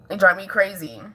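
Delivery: usual speaking voice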